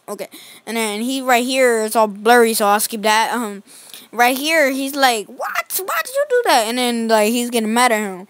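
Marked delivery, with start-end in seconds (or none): 5.3-6.7 very high outraged squeak; mock indignant high cry; shocked squeal. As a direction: leap into a falsetto what. Ronald shocked at being hit.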